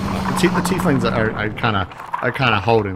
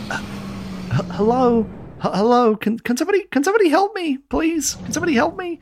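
high, tiny panicked voice calling for help; a small skull squeaking desperate pleas; fluttering cries for help; faster than usual. Acted. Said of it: "you hear a small voice, uh, yelling".

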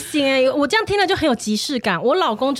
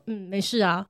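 low, much softer, withdrawn reply; mock sullen mumble; 7 semitones lower, 7 dB quieter than usual. 妻子学生闷气的老公，低声闷闷回答. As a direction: answer like a sulking husband. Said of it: "然后他就会说".

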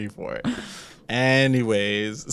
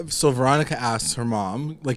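ordinary talk, nothing marked

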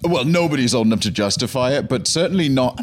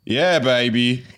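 Austin Powers catchphrase; much slower than usual. Retold or imitated. Impersonator catchphrase.

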